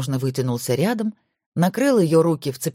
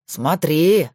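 worried warning; 4 dB louder than usual. Acted. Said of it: "озабоченно предупредил Макаров".

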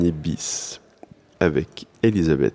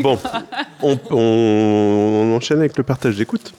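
helium voice; 3 semitones higher than usual. Real, not acted. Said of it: "l'effet est vraiment transitoire".

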